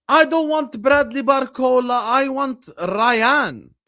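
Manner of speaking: lightly voiced imitation of a fan; mock fan voice. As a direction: parody a fan asking for Ryan. Mocking a fan's preference.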